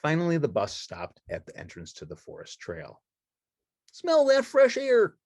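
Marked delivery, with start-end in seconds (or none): 3.9-5.2 high, slow, delighted puppet voice; Ernie voice. Acted. Ernie at the forest trail, high and delighted. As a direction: savour the air as cheerful Ernie.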